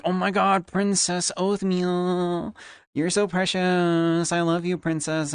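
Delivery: high, slow swooning mock fangirl; high, dragged-out mock fan cooing; gushing fan. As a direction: gush like a smitten chatter. Mimicking gushing chatters.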